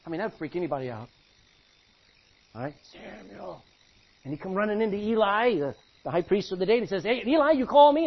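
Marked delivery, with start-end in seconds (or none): 2.9-3.6 soft, hushed call of a name; a soft voice calling in the night. Acted. The Lord's voice calling again. 7.0-8.1 put-on higher, louder boy's voice; the boy Samuel, startled and eager. Young Samuel voiced in a story.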